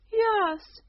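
high, loud flustered queen's yes; a high flustered royal yelp. Acted.

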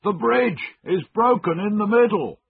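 high, loud call across a river; shouted warning. A narrator's reading. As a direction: shout the danger to those opposite. Man shouting warning across bridge.

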